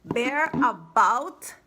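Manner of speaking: very slow, drawn-out title; reading the title. Real, not acted. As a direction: savor each word of the title. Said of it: "the book we're going to read today is".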